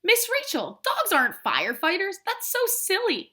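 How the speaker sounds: a hint of a small child calling it silly; child's voice. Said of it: "He said".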